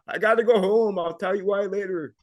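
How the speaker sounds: loud holler to someone at a distance; retold yell. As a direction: call it out urgently, as re-enacted. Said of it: "I yelled out".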